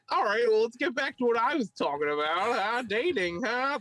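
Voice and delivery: high-pitched hammed-up comic voice; struggling comic impression. Imitating a bombed comic returning to his act, high and hammed-up. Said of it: "that's how he talks".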